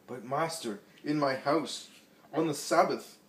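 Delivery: host objects to Jesus, scandalised; indignant protest. A narrator's reading. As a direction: protest the Sabbath breach in his house. Host protests the Sabbath cure.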